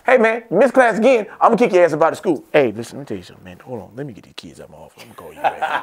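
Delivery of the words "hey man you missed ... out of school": louder, higher mock principal dad; a touch of an angry school dad; dad on phone scolding. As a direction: bark at the student like a busy dad. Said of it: "Hey, hold on".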